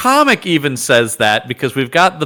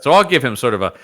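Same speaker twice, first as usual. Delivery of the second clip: ordinary talk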